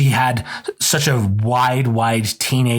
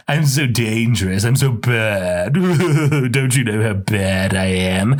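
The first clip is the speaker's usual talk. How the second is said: mock brooding Edward. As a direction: lightly ham up the tortured vampire boasting. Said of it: "he's like".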